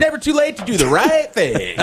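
high, hammed-up cheery convict; chipper prisoner. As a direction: play an upbeat inmate's sign-off. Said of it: "That's the Chipper Prisoner".

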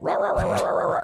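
rapid mock barks; imitated dog barking. Dog let loose among teens, imitated frantic barking. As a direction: bark like a dog bursting out of a room.

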